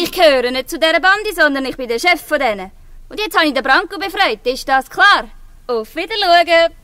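loud, proud and cheeky; defiant call. Acted. Zora proudly calls her name to the guard, loud. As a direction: throw her name at him.

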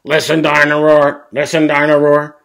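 loud, high, monotone toy chant; toy dinosaur coming alive. A narrator's reading. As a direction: blare it out like a battery toy. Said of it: "suddenly Dino Roar comes to life".

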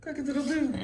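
high rooster crow; animal impressions. Shown as a demonstration. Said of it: "You hear it?".

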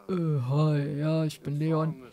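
low, slow vampire voice; extremely deep bass voice. Acted. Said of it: "Leon hat halt mega die tiefe Stimme".

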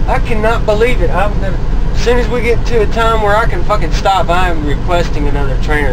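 exasperated venting. Real, not acted. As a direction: let it all out now he's gone. Alone now, venting freely.

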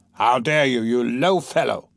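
slow, offended gentleman voice; haughty outraged reply; indignant gentleman. Acted. Gentleman insulted by the ragged man, haughty.

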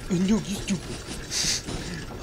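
very drawn-out, soft put-on voice; slow mock-dopey comeback to oneself; mocking retort. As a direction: stretch the comeback out slowly and quietly. Answers his own insult in another voice.

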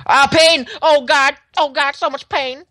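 high, hammed-up cries of pain; mock pain cries. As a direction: squeal about the pain, half joking. Falls, takes damage in game.

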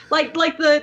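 ordinary delivery

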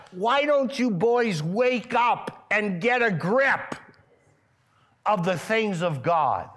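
Jesus voiced rebuking the closed-minded men, angrily; angry rebuke. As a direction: scold them to wake up.

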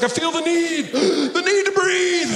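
very high, strained shout; mock movie battle cry. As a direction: gasp midway and stretch the end out. Top Gun line parody, shouted.

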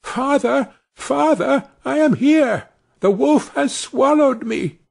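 very high calling little voice; Tom crying out. A narrator's reading. Tom cries out to his father from the wolf's belly, very high. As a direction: call to father from inside the wolf. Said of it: "and cried out".

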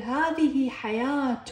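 slow, drawn-out "hayaa-tun" for حياة; حياة stretched slowly into its "-tun" ending; ta pronounced. Shown as a demonstration. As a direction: sound the final ة of حياة as "tun". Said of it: "هنا لفظتها".

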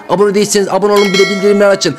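usual speaking voice

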